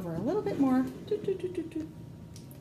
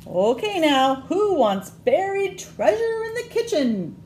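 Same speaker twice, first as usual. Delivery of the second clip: pirate mommy; 9 semitones higher, 5 dB louder than usual. Acted. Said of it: "says the pirate mommy".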